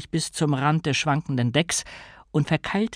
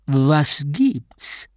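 sea queen voice. A narrator's reading. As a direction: speak as the vast sea itself. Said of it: "fragte sie".

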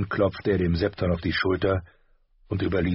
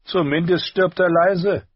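higher, louder voice for a spoiled prince; bored prince; 11 semitones higher, 6 dB louder than usual. A narrator's reading. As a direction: sneer it like a spoiled boy. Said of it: "erwiderte der Prinz".

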